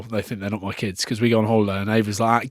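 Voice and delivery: normal talking voice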